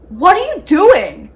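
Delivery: loud, high, drawn-out challenge; outraged player. Retold or imitated. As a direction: mimic an appalled player calling you out. Next player acted out objecting.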